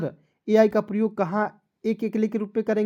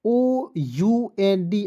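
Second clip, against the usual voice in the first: each letter drawn out slowly for the class; slow spelled out; much slower than usual. Shown as a demonstration. Teacher spelling out the rule to students, slow and deliberate. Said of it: "आपको याद रखना है".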